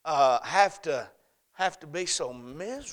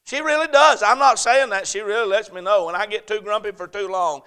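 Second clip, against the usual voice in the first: loud, high, driving sermon delivery; full-force preaching; 8 semitones higher, 14 dB louder than usual. Real, not acted. Pastor preaching to the congregation, full force. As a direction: preach it out, ringing and urgent.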